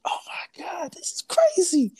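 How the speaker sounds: very high, whispery squeal; imitated high-pitched whispered freak-out; overwhelmed amazement. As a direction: mimic a squeaky, breaking cry of disbelief. Retelling own awe cutting the cord.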